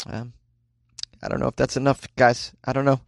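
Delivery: ordinary talk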